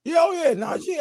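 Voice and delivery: light up at the name; surprised recognition. Real, not acted. Guest recognising the comedian's brother's name, surprised.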